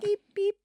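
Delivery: high, soft vocal beeps; imitated reversing beeps. Playing along with the reversing joke, high soft beeps. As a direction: mimic a truck's reverse warning, high and soft. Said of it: "Hear that reversing?".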